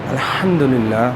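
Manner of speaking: الحمدللہ said slowly with a breathy, airy ح. Shown as a demonstration. Teacher modelling the airy ح for students. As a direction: push air through the throat on the ح of الحمدللہ. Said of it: "آواز نکالے بغیر اس کی آواز آنی چاہیے".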